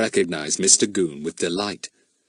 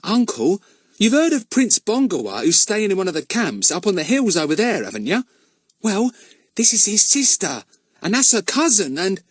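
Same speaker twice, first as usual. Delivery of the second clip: urgent; 10 semitones higher than usual. A narrator's reading. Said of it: "in an urgent voice".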